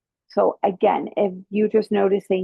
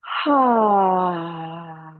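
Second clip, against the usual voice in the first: a long open-mouthed "ha" breath out; audible exhale. Shown as a demonstration. Yoga teacher demonstrating the exhale for the class, audible breath. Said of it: "I want you to exhale through your mouth".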